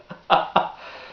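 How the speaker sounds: breathy chuckle after the punchline; short laugh. Real, not acted. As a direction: chuckle at your own story.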